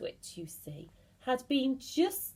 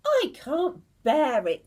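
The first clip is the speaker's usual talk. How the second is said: louder, higher wail; distraught cry; 6 semitones higher, 9 dB louder than usual. Acted. Mrs Twit crying out in despair to her husband. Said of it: "cried Missus Twit".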